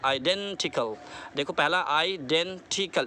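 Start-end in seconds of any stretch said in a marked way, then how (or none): 0.0-1.0 identical with डें drawn out; stress on DEN. Shown as a demonstration. Teacher shows learners where stress falls, drawn-out डें in identical. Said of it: "डेन को जो थोड़ा सा आपको stress करना पड़ेगा". 1.8-3.1 identical split into four slow parts; syllable by syllable. Shown as a demonstration. Teacher counts four syllables for learners, identical broken up slowly. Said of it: "चार syllable हुए".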